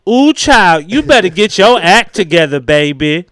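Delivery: high, loud sassy drag queen voice; Houston queen voice. Retold or imitated. Guy on a job site camping it up in sassy voice. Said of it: "talking like a motherfucking Houston queen".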